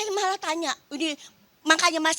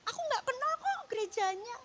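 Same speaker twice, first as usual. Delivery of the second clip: higher, retold protest to God; 10 semitones higher, 5 dB quieter than usual. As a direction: push back at God with a raised voice. Self arguing with God, retold. (protes dengan suara lebih tinggi)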